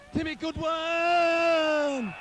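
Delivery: drawn-out, slow shout of the player's name; slow excited shout of Timmy Goodwin; excited call. Real, not acted. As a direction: stretch the name out as the ball goes through. Commentator calls scoring kick.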